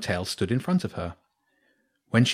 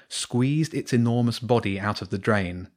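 ordinary delivery

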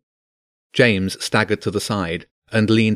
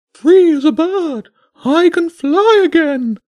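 high, loud and slow bird voice; high pigeon voice savouring every word; jubilant pigeon; 7 dB louder, slower than usual. Acted. Pigeon freed, crying out.

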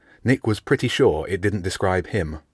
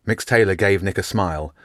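plain reading voice

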